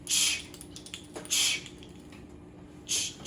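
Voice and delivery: short unvoiced "ch", no vowel after it; isolated CH sound. Shown as a demonstration. Teacher asking students which one can say it, isolated CH sound. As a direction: puff out each "ch" separately with pauses. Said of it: "Which one can say".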